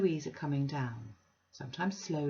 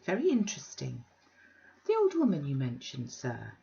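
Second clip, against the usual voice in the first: prim Mrs Sparsit. Acted. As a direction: lift it into a prissy, mannered voice. Sparsit asks about old woman.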